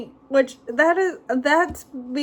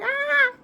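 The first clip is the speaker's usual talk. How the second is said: short high scream, a touch mock; retold scream. Recalling the car sliding into the ditch, she gives a high mock scream. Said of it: "and I was screaming".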